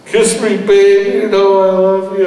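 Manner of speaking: put-on high, loud, sloppy drunken plea; drunk pleading. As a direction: beg for a kiss, loud and slurry. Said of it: "is so drunk by then".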